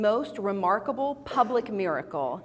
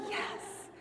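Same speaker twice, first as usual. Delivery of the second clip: whispered, delighted yes; retold silent victory cheer under the breath; hushed cheer; 11 semitones higher, 15 dB quieter than usual. Proud mother hearing son will pray, whispered cheer.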